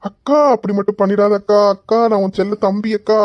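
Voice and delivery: hammed-up pleading; sudden wheedling. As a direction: beg big sister like her pet little brother. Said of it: "எப்படி மாறி பேசுறான்".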